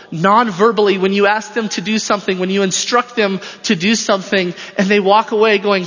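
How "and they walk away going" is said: ordinary delivery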